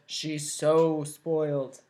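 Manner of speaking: low, slow sour drawl; Waffle's voice. Acted. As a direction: drag it out with scorn. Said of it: "Waffle said".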